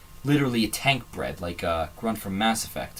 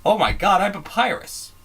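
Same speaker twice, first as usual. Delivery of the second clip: surprised realization; 8 semitones higher, 3 dB louder than usual. Real, not acted. Realizing he is like Papyrus.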